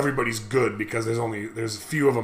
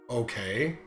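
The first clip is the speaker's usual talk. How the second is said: quiet, low retold acceptance; taken aback; 5 semitones lower, 10 dB quieter than usual. Student turned down by teacher, retold taken aback. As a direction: back down softly and uncertainly.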